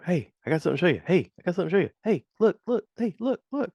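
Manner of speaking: put-on high, eager pestering kid; high-pitched, insistent little-kid pestering; pestering little sibling. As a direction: nag for attention like a little brother or sister. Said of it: "having a little sister or a little brother go".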